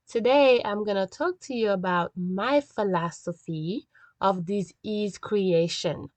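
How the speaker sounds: ordinary talk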